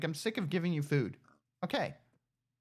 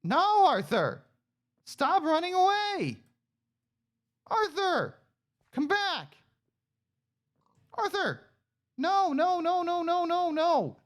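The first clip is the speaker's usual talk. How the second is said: high loud frantic calls; frantic shout; 11 semitones higher, 4 dB louder than usual. Real, not acted. Player chasing pig Arthur, frantic high shouting. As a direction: yell after the runaway.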